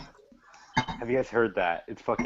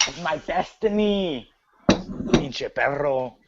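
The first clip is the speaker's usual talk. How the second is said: higher, slow Mexican-accented impression; mock Mexican accent; 3 semitones higher, 3 dB louder, much slower than usual. Imitating Del Rio's catchphrase for friends, mock Mexican accent. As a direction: draw it out in a put-on Mexican accent. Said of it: "not in an accent".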